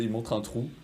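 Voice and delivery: ordinary talk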